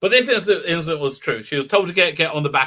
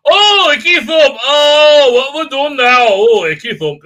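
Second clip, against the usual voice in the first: much higher, louder hammed-up alarm; mock Doctor panic; 9 semitones higher, 8 dB louder than usual. Reviewer mocking the Doctor, high loud hammed-up panic. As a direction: flap about like a panicked Doctor.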